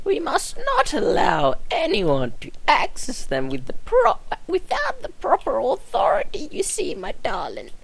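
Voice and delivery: put-on a frail old woman remembering; old nurse voice.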